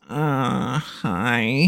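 soft, awkward nerd voice; Garf. Acted. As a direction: say it like a weird, awkward outcast. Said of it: "awkward, nerdy character".

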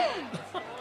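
soft, high little laugh; light appreciative titter; amused chuckle. Acted. Diner enjoys friend's wordplay, amused chuckle.

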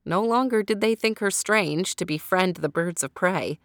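ordinary delivery

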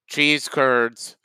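ordinary delivery

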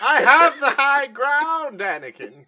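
hammed-up movie line through laughter; Obi-Wan quote. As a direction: stretch it out, cracking up. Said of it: "You can't win".

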